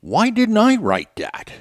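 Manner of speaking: high, slowed rhetorical "why didn't I"; amazed envy. Retold or imitated. Host recalling reading a great article, mock amazed envy. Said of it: "you read something and you go".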